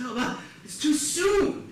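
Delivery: exaggerated "too soon" complaint; mock protest. Mimics objection to his joke.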